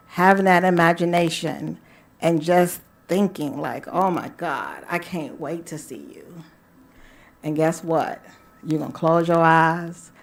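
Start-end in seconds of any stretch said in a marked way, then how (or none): 3.9-6.4 soft, lowered, dreamy longing; longing murmur. Acted. Thinking of seeing a lover tonight, softly longing. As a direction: let it sink into a soft private thought.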